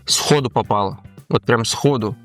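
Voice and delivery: usual speaking voice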